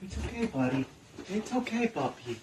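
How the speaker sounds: soft reassuring voice to a puppy; soothing a scared dog. Real, not acted. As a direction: gently coax a frightened dog in. Calming a dog.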